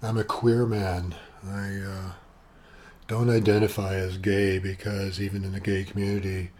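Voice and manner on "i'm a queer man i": normal talking voice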